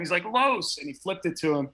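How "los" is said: higher-pitched mock yell; retold scream. Quarterback calling to his teammate as he goes down, mock scream. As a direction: imitate a quarterback's yell, pitched up without much volume. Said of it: "he screamed at him".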